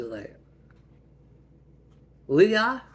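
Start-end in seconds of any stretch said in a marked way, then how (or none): 2.3-2.8 loud, high, rising call; indignant accusing shout; confronting a sister. Acted. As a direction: demand an answer with the name. Mother confronts sister over insult.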